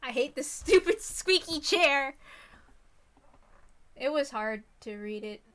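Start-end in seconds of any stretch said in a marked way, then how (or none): none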